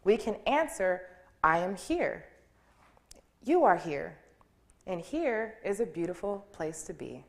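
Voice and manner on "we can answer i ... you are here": ordinary talk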